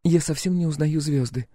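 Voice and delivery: high-pitched female character voice; high voice, remarking out of the blue; Clementine. A narrator's reading. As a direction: lift the voice high for the puzzled girlfriend. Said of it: "произнесла Клементина".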